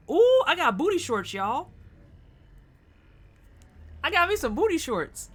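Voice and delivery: high, loud, thrilled squeal; excited squeal. Real, not acted. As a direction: squeal with delight over the loot. Excited about a game item.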